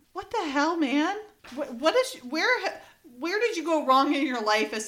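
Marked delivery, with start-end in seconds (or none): none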